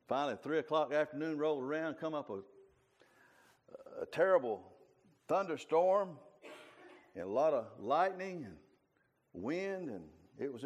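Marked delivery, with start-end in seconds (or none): none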